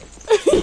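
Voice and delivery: short, loud high laugh; amused laugh. Real, not acted. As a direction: burst into a brief high laugh. Amused by remarks on own accent.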